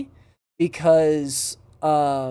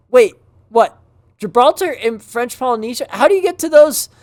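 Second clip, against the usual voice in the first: higher, louder, mock-incredulous questioning; baffled retelling with raised pitch and volume; imitated bafflement; 7 semitones higher, 7 dB louder than usual. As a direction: act out being thrown and confused. Retold baffled reaction to destinations.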